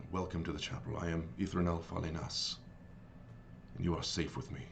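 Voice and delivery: soft, low, soothing welcome; very serene. Acted. Abbot welcomes weary adventurers to the chapel, softly serene. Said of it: "in a very serene voice".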